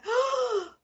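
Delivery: high mock startled cry; a sudden high yelp of fright; startled gasp. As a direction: act out a jump of fright. People startled by her eyes.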